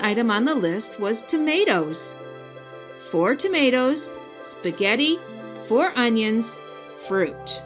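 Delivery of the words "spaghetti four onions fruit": usual speaking voice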